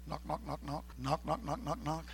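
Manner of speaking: quiet, low spoken knocks; soft knocking. Retold or imitated. Preacher acting out knocking at the door, soft knocks. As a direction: tap out the knocks softly.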